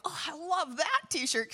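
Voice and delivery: high, gushing exclamation; re-enacted enthusiastic compliment; retold delight. Acts out own delighted exclamation.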